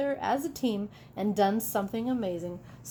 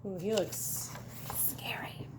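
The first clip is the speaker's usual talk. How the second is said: spooky hush; 2 semitones lower, 10 dB quieter than usual. Acted. Spooky aside about the monster.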